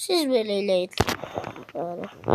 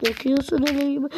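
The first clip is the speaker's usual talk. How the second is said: ordinary delivery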